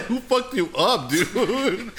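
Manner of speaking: laughing disbelief. Retold or imitated. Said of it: "I was like".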